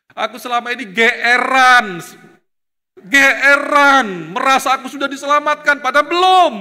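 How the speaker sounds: loud, high confession to God; anguished prayer cry. Retold or imitated. Young man kneeling in prayer, crying out loud to God. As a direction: cry out the self-deception. Tearful prayer in his room.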